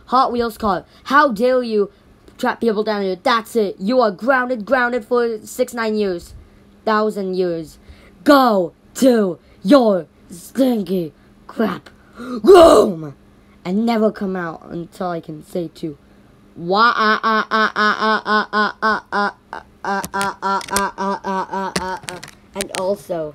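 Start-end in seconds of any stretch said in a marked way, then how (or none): 0.1-16.0 loud, high furious telling-off; angry scolding parent. Pretend. Parent grounds the Hot Wheels car, furious yelling. 16.6-22.2 ah ah sounds starting loud and fading down; loud fading ah ah laugh. Pretend. Parent's tirade turns into laughing ahs, loud then fading.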